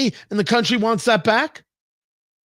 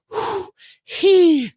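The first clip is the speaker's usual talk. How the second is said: hammed-up exhale, then high-pitched gasp; winded panting; 11 semitones higher than usual. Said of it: "I'm winded".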